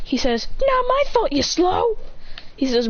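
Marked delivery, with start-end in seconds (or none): 0.6-2.0 high taunt; mock her for being slow. Boy taunts his sister, high taunt.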